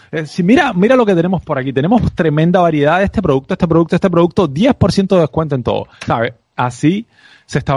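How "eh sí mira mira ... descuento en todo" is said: pushy salesman. Retold or imitated. Mocking a pushy salesman who hawks his discounts. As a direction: push the product like a pushy street seller. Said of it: "un vendedor es una persona que está todo el tiempo empujando".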